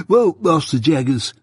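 stuffy, snuffly voice of a man with a heavy cold. A narrator's reading. Mike answering Jaggers, stuffy with a chronic cold. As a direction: speak as if your nose is blocked. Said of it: "in the voice of a sufferer from a constitutional cold".

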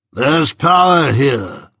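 very slow, stretched pronouncement; Jaggers, impressed. A narrator's reading. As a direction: savour each word slowly as you show it off. Jaggers displays the wrist.

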